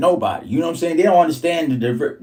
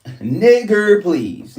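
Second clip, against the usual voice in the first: dismissive scoff; 5 semitones higher, 3 dB louder, much slower than usual. Retold or imitated. Dismissive retort repeated.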